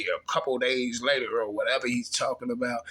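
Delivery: normal talking voice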